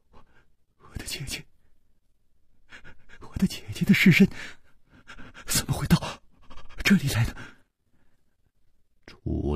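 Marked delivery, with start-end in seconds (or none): 0.1-7.3 halting, breathy and tearful; trembling voice. A narrator's reading. Weeping girl asking about her sister's body, trembling. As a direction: stammer it in broken pieces, trembling. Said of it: "他颤声说".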